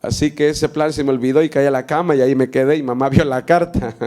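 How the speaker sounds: usual speaking voice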